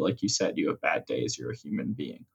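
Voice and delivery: usual speaking voice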